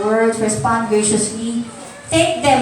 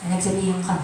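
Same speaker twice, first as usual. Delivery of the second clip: pinagsabihan ka said low and much softer, trailing off; soft take; 5 semitones lower, 6 dB quieter, faster than usual. Shown as a demonstration. Preacher repeating the scolding to the congregation, soft and low. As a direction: drop pinagsabihan ka to a gentle murmur.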